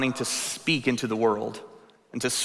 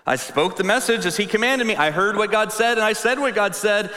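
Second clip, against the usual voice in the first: higher, louder declaration building up; preaching Ezekiel; 8 semitones higher, 4 dB louder than usual. Acted. Ezekiel proclaiming his obedience, loud and rising.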